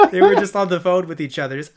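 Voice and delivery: ordinary talk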